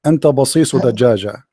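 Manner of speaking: low, measured classical Arabic recitation; mock classical poetry. Shown as a demonstration. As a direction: declaim an improvised insult in formal Arabic verse. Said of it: "I just made a poetry". (إلقاء شعر هجائي بالفصحى)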